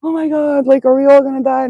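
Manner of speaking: mocking a worried viewer's panic; mock panicked viewer. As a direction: imitate a frightened viewer overreacting. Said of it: "I don't wanna hear, like".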